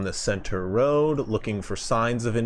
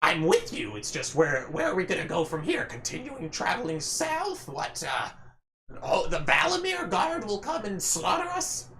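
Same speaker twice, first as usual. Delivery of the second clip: higher, worried goblin pleading; anxious goblin voice; 4 semitones higher, 5 dB quieter than usual. Acted. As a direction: pitch up into a nervous goblin. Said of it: "they're speaking".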